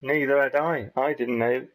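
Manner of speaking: ordinary delivery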